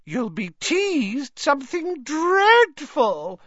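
high, slow ghostly glee; gleeful ghost. A narrator's reading. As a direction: gloat like a delighted ghost. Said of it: "said Myrtle happily".

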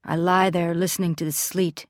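ordinary delivery, nothing marked

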